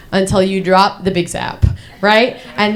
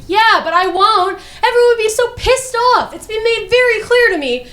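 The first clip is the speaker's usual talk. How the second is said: very high, indignant comic outburst; exasperated protest; 10 semitones higher than usual. Acted. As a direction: protest at the top of your pitch. Acted protest to the doctor.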